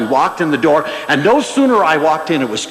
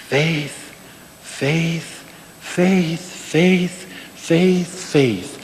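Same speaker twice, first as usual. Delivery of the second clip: soft repeated word; 5 dB quieter, much slower than usual. Retold or imitated. Little minister, eyes closed, preaching.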